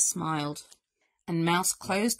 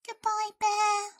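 high, thin mouse voice; murmuring mouse; 12 semitones higher than usual. A narrator's reading. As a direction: murmur a farewell in a tiny high voice. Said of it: "murmured Mouse".

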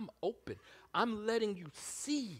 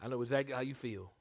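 casual Jesus; 7 semitones lower, 3 dB quieter, faster than usual. Retold or imitated. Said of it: "Jesus on the side is like".

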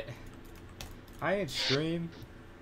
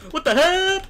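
high, loud shrieking scream; startled scream; 13 dB louder than usual. Real, not acted. Gamer shrieking when ambushed, high loud scream. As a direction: scream in shock at the hit. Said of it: "That scream was not a scream of, uh, terror".

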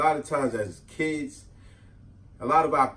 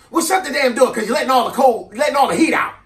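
higher, louder shouted order; aunt yelling; 8 semitones higher, 7 dB louder than usual. Retold or imitated. Aunt shouts at the kid to shut the door. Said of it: "my aunt would yell".

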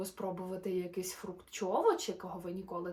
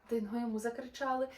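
lively, sing-song storybook narration; animated bedtime-story voice; reading to children; 5 semitones higher, 4 dB louder than usual. A narrator's reading. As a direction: read the rhymes brightly to little listeners. Reads a rhyming children's story.

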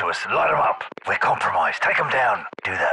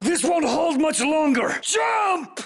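battle shout; 7 semitones higher, slower than usual. Acted. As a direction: roar orders over gunfire. Chase under fire.

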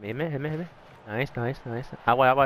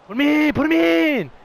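shouting; 5 dB louder than usual. Real, not acted. Yelling at teammate in game.

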